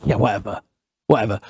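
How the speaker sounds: low, softened dismissive reply; dismissive brush-off. Retold or imitated. Brushing off the man who saw him crying, low and dismissive. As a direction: brush it off under your breath. Retold brush-off of the man's claim.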